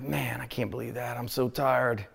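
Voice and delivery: low, quiet, worn-out grumble; tired muttering. Retold or imitated. Exhausted driver talking to himself after the near miss, low and tired. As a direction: let it drop low and soft, drained. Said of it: "And I'm exhausted".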